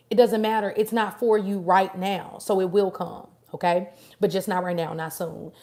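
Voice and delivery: ordinary talk, nothing marked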